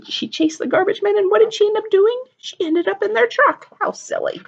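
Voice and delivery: high, lively and delighted; excited. Real, not acted. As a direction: bubble over at the silly part. Teacher excited about the silly story.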